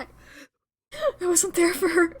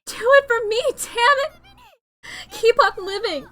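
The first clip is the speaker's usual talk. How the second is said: higher and louder, begging him to live; desperate pleading; 6 semitones higher, 5 dB louder than usual. Acted. Boy begs his dying friend to keep living, desperate shout. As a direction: shout through the despair to save him.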